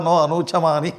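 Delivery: ordinary talk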